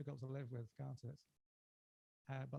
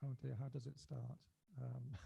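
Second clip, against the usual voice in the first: ordinary talk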